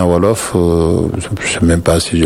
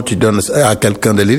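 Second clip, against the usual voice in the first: normal talking voice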